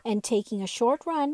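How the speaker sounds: plain reading voice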